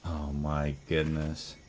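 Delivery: slow awed sigh. Real, not acted.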